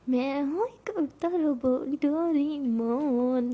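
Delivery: slow, put-on cartoon robot voice; playful Doraemon voice. Teasing her girlfriend as Doraemon, slow put-on cartoon voice. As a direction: tease her in a silly cartoon voice. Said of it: "I love Doraemon".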